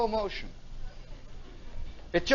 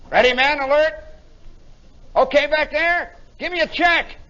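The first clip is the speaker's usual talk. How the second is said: raised, louder crew-call voice; lieutenant on the intercom; 5 semitones higher, 4 dB louder than usual. Retold or imitated. Lieutenant calling back to his crew, raised intercom call. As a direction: call out the checks like an officer. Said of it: "The lieutenant is saying".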